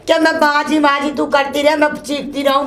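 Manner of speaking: normal talking voice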